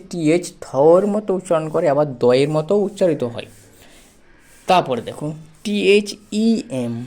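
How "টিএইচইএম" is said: letters spelled out very slowly; slow spelling. Shown as a demonstration. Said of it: "দেম". (ধীরে ধীরে বানান করে)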